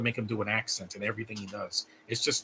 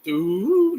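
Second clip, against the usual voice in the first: excited ooh; 9 semitones higher, 6 dB louder than usual. Real, not acted. Reacting to food on screen.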